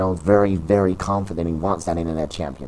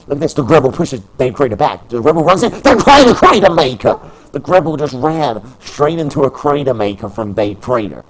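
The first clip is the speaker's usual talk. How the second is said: rising to a high, loud shout on the finisher; building excitement; 6 semitones higher, 8 dB louder than usual. Acted. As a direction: build up and explode on the move. Finishing move called.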